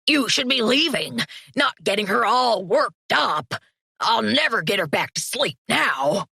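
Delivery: lightly voiced grumpy parent, rising to a shout; a mild exasperated scold; grumpy parent dog scolding the visitor, building to a shout; annoyed complaint. Groton complains, pup worked up.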